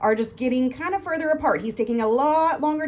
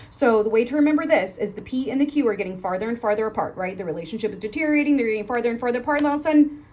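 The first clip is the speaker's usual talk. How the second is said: ordinary delivery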